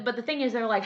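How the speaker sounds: normal talking voice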